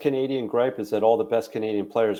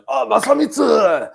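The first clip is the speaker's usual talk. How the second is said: much higher, louder mock Japanese exclamation; mock Japanese accent; 12 semitones higher, 8 dB louder than usual. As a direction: friends hamming up the dad's name in a Japanese accent. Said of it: "they'd be like".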